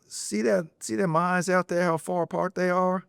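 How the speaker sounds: high, lightly drawled Cajun cousin voice; imitating cousin. Telling his friend about gators, a high imitated cousin voice. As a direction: pitch it up like the country cousin spotting eyes.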